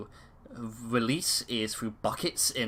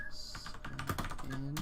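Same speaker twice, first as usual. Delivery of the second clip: ordinary talk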